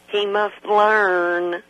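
ordinary delivery

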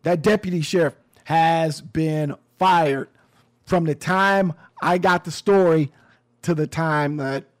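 ordinary delivery

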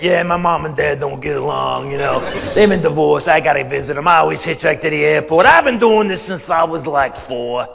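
a hint of tough iron worker bluster. Eleven-year-old lying to driver, mock iron worker. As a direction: kid faking a gruff grown-up, lightly voiced. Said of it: "my best eleven-year-old iron worker voice".

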